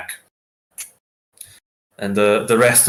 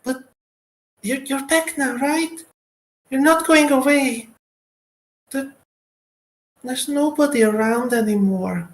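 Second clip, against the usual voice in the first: put-on little girl voice. Little girl NPC pleading.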